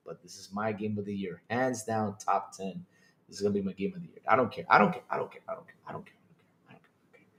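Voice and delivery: low, soft insistence trailing into near silence; fading away. Real, not acted.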